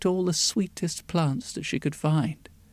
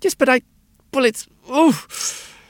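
gagging on the smell; 8 semitones higher than usual. A narrator's reading. Old woman choking on smell.

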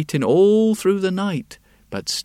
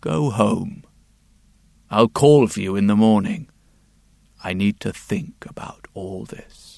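grave king; 4 semitones lower than usual. Acted. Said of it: "and he said".

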